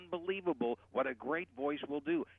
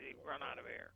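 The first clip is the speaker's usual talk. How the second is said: much softer fry at the bottom; a demo of vocal fry; glottal fry; 3 semitones higher, 10 dB quieter than usual. Shown as a demonstration. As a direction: let the voice crackle out of air. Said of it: "sounds like bacon frying".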